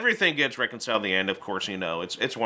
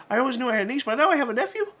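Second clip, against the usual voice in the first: much higher, put-on comic uncle; goofy uncle voice; 11 semitones higher, much faster than usual. Uncle Brill meeting his nephew, high goofy put-on voice. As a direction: play the delighted oddball uncle.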